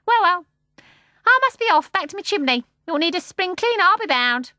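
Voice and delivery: high, loud bird voice; starling leaving, brisk and high. A narrator's reading. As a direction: shake it off briskly.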